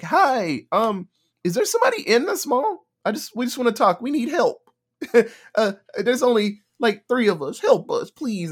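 high, sweet, fake-helpless plea; fake pleading. Biker talker begging mall survivors over the radio, high fake plea. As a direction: play innocent to get inside.

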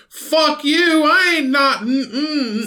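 high, far put-on yokel outburst; hillbilly voice. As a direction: yell it like a cranky hillbilly. Mock outburst at potato advice.

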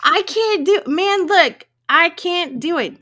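high, pleading exasperation; exasperated protest. Real, not acted. Cohost protesting Latin is beyond them, high exasperated plea.

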